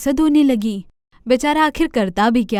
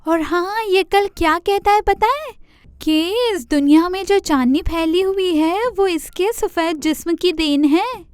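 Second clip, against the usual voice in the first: much higher pleading deer voice; complaining deer; 8 semitones higher than usual. Acted. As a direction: tattle to Chanda Mama. Deer tattles on rabbit.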